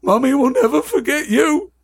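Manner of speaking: high-pitched, hammed-up tearful wail; acted heartbroken farewell; sobbing Hagrid. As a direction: cry it out like a doting mother bereft. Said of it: "Hagrid sobbed".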